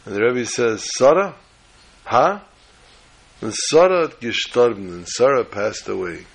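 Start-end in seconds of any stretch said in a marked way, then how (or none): 1.0-2.4 higher, louder, puzzled echo; roused and questioning. Retold or imitated. Rebbe roused from thought by the child, puzzled. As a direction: snap out of thought with a rising question.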